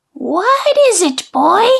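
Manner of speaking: a lightly voiced croaky old hag; croaking old witch. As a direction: croak it out like a mild spooky old woman. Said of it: "she croaked".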